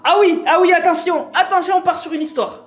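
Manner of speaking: high, loud burst of anticipation; excited hype. Real, not acted. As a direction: hype up the big story. Hyping up the story to come.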